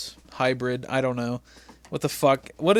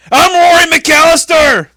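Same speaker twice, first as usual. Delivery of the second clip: slow, much louder and higher bellow; shouting in his ear; 14 semitones higher, 13 dB louder, slower than usual. Retold or imitated. Imitating a Highlander yelling into the boss's ear, shouting. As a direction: yell it right into his ear. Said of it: "he gets right up in his ear".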